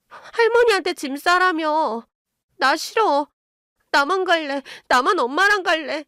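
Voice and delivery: put-on high, childish pleading; tearful protest. A narrator's reading. Daughter refuses to go with grandmother, tearful high protest. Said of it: "갑자기 울면서".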